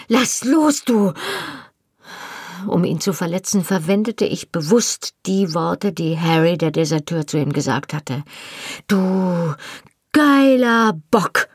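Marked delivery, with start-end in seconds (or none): 0.1-1.1 high-pitched screeched demand; screeching. A narrator's reading. Wife being shaken by her husband, screeching. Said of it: "kreischte ich". 8.9-11.5 high, loud, drawn-out insult; furious insult. A narrator's reading. Wife insults husband to hurt him, furious.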